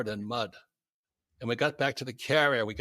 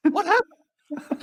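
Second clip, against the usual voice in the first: high, slow, laughing alarm; half-laughing shock at the sinking helicopter; startled cry; 6 dB louder, much slower than usual. Retold or imitated. Pilot sees helicopter sinking.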